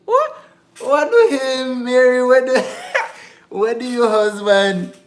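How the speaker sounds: hammed-up incredulous squeal; mock outrage. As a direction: shriek it back at him in fake shock, with a laugh. Mocking disbelief at husband's remark.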